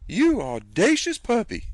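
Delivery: high, loud indignant cry; scandalised aunt after a stolen kiss; outraged aunt. Acted. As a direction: fling it at him like a slap. Wiping off an unwanted kiss.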